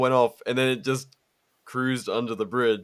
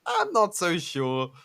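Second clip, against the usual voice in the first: ordinary talk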